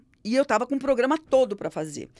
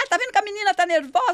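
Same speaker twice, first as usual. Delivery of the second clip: higher, louder scolding at the door; imitated yell; 8 semitones higher, 4 dB louder, faster than usual. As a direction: yell protectively at the intruder. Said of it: "começou a gritar".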